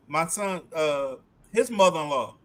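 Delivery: ordinary delivery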